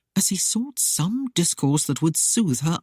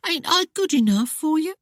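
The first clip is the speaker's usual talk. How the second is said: high wounded-sounding Cockney plea; mock hurt Cockney; 13 semitones higher than usual. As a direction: fake a pout to provoke him. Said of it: "feigned tone of hurt".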